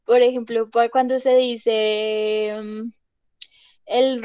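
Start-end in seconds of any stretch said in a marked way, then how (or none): none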